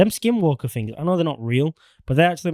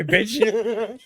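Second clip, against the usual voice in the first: laughing; 9 semitones higher than usual. Retold or imitated. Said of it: "He's like".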